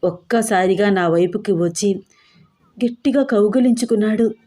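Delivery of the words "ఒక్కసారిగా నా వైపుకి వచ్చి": ordinary delivery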